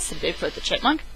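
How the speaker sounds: ordinary delivery, nothing marked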